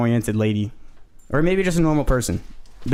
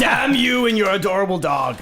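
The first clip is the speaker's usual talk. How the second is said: mock curse; 6 semitones higher than usual. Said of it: "and be like".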